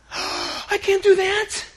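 gasp, then a high, drawn-out protest; mock panic. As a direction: act out panicking at a huge request. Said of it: "That's why we panic".

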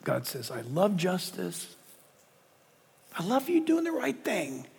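ordinary talk

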